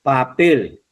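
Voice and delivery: "Papel" with the weight on "pel"; teacher's demo of "Papel", accent on "pel"; stress on last syllable. Shown as a demonstration. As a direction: lean on the final syllable of "Papel". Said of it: "acento en la, en la, la sílaba".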